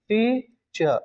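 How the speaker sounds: Teacher in two beats, टी, चर; teacher breaking teacher apart; split into syllables. Shown as a demonstration. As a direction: pause between टी and चर. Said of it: "teacher word में विद्यार्थी दो syllable".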